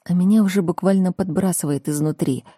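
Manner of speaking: plain reading voice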